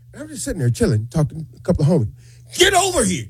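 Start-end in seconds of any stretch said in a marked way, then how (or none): 2.6-3.2 high-pitched mock yell; imitated yell. Sean yells at Al to come over, mock yelling. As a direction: imitate a boss yelling across the room. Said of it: "he's yelling at me".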